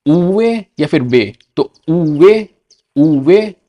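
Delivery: उवे or बे, slow and clear; Spanish letter name. Shown as a demonstration. Teacher to students, Spanish letter name. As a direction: model उवे firmly. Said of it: "वी को कहते हैं".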